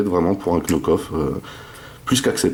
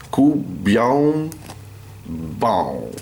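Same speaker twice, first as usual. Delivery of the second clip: a light mock Chinese accent on the brand name; accent chinois imité; 4 semitones higher, much slower than usual. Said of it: "excusez mon accent chinois". (léger accent chinois imité)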